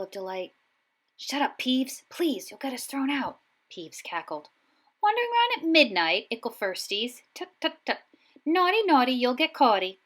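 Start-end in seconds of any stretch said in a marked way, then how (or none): 1.2-3.4 higher, urgent pleading; urgent plea. Acted. 5.0-10.0 high, loud singsong taunting; mischievous poltergeist. Acted. Peeves taunting the students.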